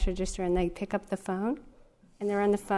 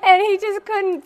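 higher and louder, breaking into laughter; laughing through words; 9 semitones higher, 7 dB louder than usual. Real, not acted. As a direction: say it while laughing helplessly. Laughing hard while telling the story.

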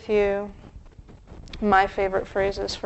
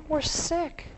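ordinary talk

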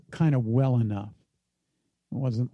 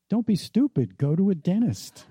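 higher-pitched mock reprimand to himself; comic self-scolding; 6 semitones higher than usual. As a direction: deliver the blunt insight as a punchline, pitched up. Inner insight scolds him, comically.

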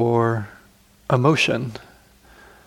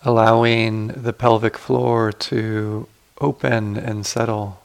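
guided meditation. Acted. Teacher guiding sitters to relax the body, calm guided meditation.

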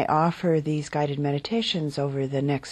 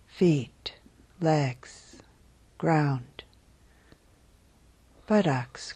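unhurried, calm body-scan voice; guided meditation. Real, not acted. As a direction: guide them gently into settling, phrase by phrase. Leads a guided body-awareness meditation.

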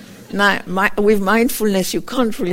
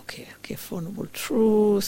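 whispering to herself; 5 dB quieter than usual. Retold or imitated. A nun rehearsing the teaching to herself before guests, whispering. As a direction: murmur the list under your breath so no one hears.

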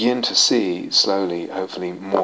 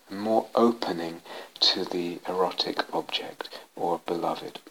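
soft whisper fading out on beloved; hushed, much softer whisper; 3 semitones lower, 7 dB quieter than usual. Acted. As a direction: let the list die into a whisper. Trailing whispered list.

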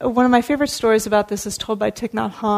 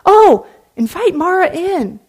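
welcoming; 8 semitones higher, 9 dB louder than usual. Acted. Buddha welcoming Mara.

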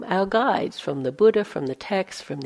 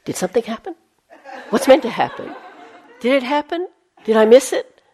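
anxious inner voice; 6 semitones higher, 5 dB louder, faster than usual. Retold or imitated. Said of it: "I could up Periscope".

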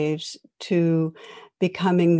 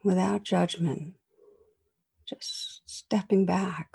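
guided meditation. Acted. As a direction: guide listeners through calm reflection. Leads a reflection meditation.

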